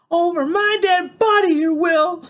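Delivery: screaming through tears, very high and loud; grandma shrieking in tears, slow; tearful yelling. Acted. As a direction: scream it at him, crying. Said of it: "That's Graham yelling".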